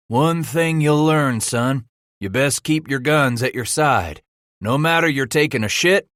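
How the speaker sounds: a big trapper schooling the greenhorn; rough mountain man. A narrator's reading. Cooper lecturing the young trapper.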